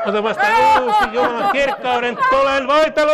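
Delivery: high, loud cry over the congregation; ecstatic prayer shout. Real, not acted. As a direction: shout the blessing at full fervour.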